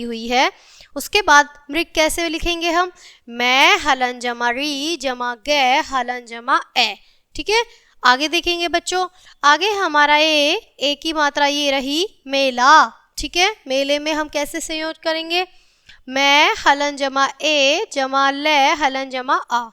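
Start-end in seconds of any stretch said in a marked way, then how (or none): 3.3-6.9 slow, syllable by syllable; slow spelling out. Shown as a demonstration. Teacher spells out letter by letter. 16.1-19.7 slow, syllable by syllable; slow spelling out. Shown as a demonstration. Teacher spells out letter by letter.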